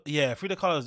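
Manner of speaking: usual speaking voice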